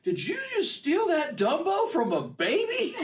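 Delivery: slow, high-pitched incredulous yell; mock yell. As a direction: imitate an accuser shouting from the line. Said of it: "yells to Ryan".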